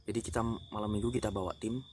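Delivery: ordinary talk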